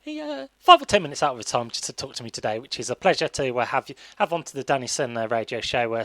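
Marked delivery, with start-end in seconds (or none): none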